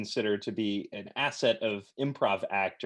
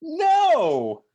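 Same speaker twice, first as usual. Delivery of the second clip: excited cry; 8 dB louder than usual. Pretend. Oh no in story.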